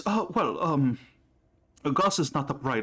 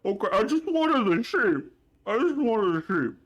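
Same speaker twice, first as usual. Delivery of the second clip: sulky brute; 6 semitones higher than usual. Acted. Gus sulks to Lilith.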